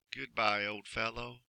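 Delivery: soft, sad farewell. Acted. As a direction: say goodbye with a heavy heart. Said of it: "he called out sadly".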